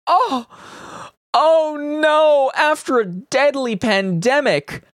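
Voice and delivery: high, slow sarcastic oh no; mock alarm. Podcaster mocking MPs' cost worries, in slow fake horror.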